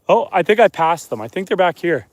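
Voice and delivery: usual speaking voice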